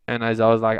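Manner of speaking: plain reading voice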